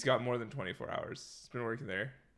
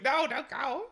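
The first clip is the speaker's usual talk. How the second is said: much higher little dog begging; pleading dog voice; 12 semitones higher, 3 dB louder than usual. Pretend. Dog waving paw at owner, high pleading dog voice. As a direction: squeak it like a pup who wants belly rubs. Voicing the dog's plea.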